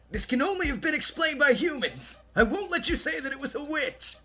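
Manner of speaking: high, loud mock-dramatic proclamation; hammed-up declaration. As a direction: declaim it like an over-the-top objection. Declaims mock Umineko line in fun.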